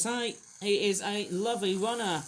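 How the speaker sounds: normal talking voice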